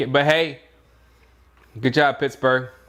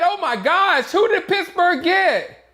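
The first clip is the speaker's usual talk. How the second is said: higher and laughing, slower at the end; mock astonishment; 12 semitones higher than usual. Voicing Franco reacting to Pittsburgh's pick, laughing in mock shock. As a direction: act out giddy disbelief at the pick. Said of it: "he said".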